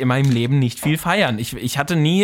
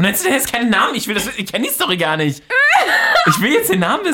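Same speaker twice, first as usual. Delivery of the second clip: laughing demand; 8 semitones higher than usual. Real, not acted. Friend demands the name, laughing.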